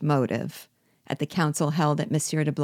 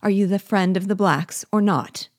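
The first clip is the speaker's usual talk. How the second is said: higher and louder challenge; demanding cry; 5 semitones higher, 5 dB louder than usual. A narrator's reading. General demands the prisoner's loyalty, loud challenging cry. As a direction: force him to pick a side. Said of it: "cried Biassou".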